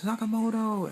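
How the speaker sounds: high, loud scream of his name; high-pitched retold scream across the forest. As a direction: shriek his name to make him turn.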